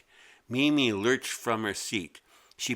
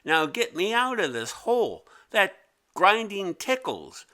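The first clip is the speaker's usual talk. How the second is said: much higher, giggly creature voice; 11 semitones higher, 4 dB louder than usual. Acted. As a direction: squeal it out, tickled. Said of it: "The yellow thing giggled".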